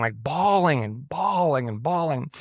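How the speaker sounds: slow, stretched "bawling" each time; drawn-out repetition. Real, not acted. Pastor recounting endless crying in the car, each "bawling" stretched slowly. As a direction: draw out every "bawling" in an even, rolling rhythm. Dwells on how long he cried.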